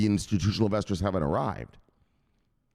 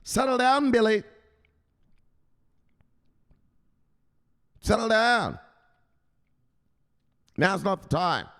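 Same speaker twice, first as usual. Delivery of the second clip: telling off; 13 semitones higher than usual. Retold or imitated. Telling brother Billy to settle down, high and firm. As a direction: shut him down, pitched up. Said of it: "you gotta tell that motherfucker".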